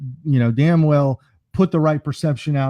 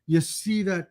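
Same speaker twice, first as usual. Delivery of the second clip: higher, softer Scottish attempt slipping into a laugh; failing Scottish accent; 5 semitones higher, 3 dB quieter than usual. Old man pointing at the pier, Scottish accent collapsing. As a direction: let the bad Scottish accent fall apart. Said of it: "my accent's just gone".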